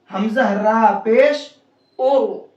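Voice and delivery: slow spelling to a full "और"; heavy Ra. Shown as a demonstration. Teacher spelling it for students, heavy Ra. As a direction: stretch it out, ending on rounded "और". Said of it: "मोटा करके पढ़ेंगे".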